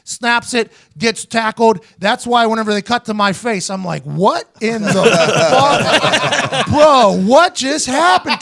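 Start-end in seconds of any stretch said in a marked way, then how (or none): 4.0-8.4 stunned disbelief. Retold or imitated. Stunned at the botched fake.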